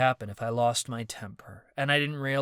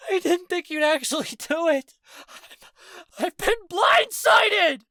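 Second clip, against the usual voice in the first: much higher, louder tearful wail; weeping outcry; 7 dB louder than usual. Acted. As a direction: wail it through tears. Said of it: "Marcus began to weep".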